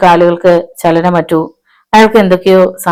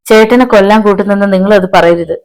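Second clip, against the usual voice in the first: usual speaking voice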